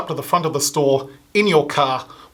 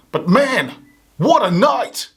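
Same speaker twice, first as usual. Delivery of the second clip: gleeful; 7 semitones higher, slower than usual. Acted. Addict relishing his wild past.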